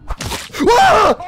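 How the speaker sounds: high, loud yelp; startled scream. Real, not acted. Imposter player caught off guard, startled scream. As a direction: cry out in sudden shock.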